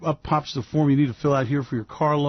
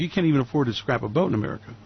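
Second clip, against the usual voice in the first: ordinary delivery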